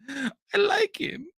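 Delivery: high, slow mocking woman's voice; mock sister voice. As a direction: stretch it out in a high voice. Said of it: "she goes".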